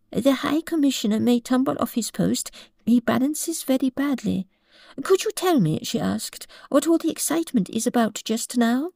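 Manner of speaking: a curious little girl, gently voiced; polite, curious child reading aloud; Alice's voice. A narrator's reading. Alice's voice in story.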